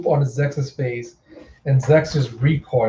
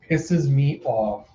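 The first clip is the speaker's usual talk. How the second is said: usual speaking voice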